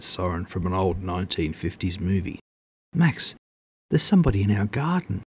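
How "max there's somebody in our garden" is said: high, quickened cry of alarm; alarmed squeal. A narrator's reading. Startled woman alerts Max to an intruder, high alarmed squeal. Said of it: "Her squeal pierced the serenity".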